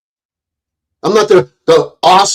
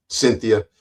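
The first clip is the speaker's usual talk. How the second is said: ordinary talk, nothing marked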